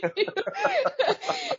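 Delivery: long bright burst of laughter; high laugh. Real, not acted. Laughing after dad quote.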